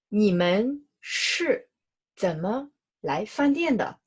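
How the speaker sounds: drawn out very slowly, one word at a time; slow model question. Shown as a demonstration. Teacher reads the last example very slowly to students. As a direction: stretch each word for the learners. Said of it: "那last sentence".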